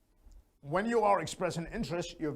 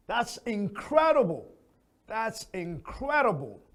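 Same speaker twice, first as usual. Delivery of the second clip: higher and louder "That's incredible"; excited; 5 semitones higher, 3 dB louder than usual. Shown as a demonstration. Teacher showing a strong reply, excited. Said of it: "higher and louder".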